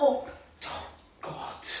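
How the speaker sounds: breathless, scared whisper; terrified whisper. Acted. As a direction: gasp it out in fear. Terrified by banging stranger.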